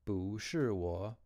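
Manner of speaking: 不是我 said slowly, tones drawn out; slow Chinese tone demo. Shown as a demonstration. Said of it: "bu is technically a falling tone".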